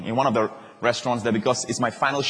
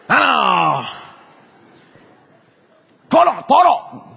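much higher, louder shout of Toro; shouting order; 9 semitones higher, 7 dB louder, much slower than usual. Retold or imitated. Pastor shouting his tuna order at the sushi bar. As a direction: belt out Toro to the chef. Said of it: "If you speak softly, they don't understand".

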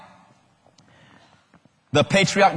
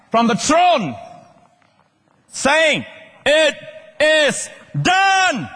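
high, drawn-out shouted proclamation; loud voice from heaven; 10 semitones higher, much slower than usual. Acted. Preacher voicing God from the throne, loud proclamation. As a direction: cry it out word by word, held long. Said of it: "a loud voice came out".

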